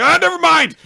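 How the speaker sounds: acted high, loud panicked shriek; flustered outburst. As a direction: shriek it as you suddenly back out. Retold flustered sudden backing out.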